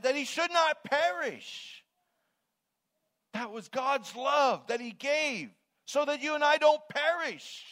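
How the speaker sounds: NOT PERISH shouted high, word by word; emphatic preaching. Shown as a demonstration. Preacher emphasizing God's love to the congregation, impassioned shout. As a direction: cry out "should not perish" loud and high. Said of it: "We can emphasize".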